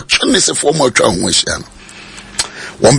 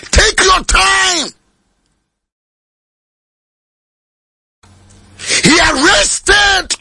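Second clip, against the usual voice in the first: full-force preaching; 14 semitones higher than usual. Acted. Preacher to congregation, calling out at full force. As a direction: preach it out to the rafters.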